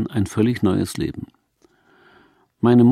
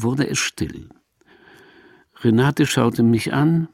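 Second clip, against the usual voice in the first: plain reading voice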